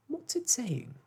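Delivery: put-on high, eager little boy's question. A narrator's reading. Michael asks his sister about the bird, high and eager. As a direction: pipe up like a curious child. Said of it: "Michael began".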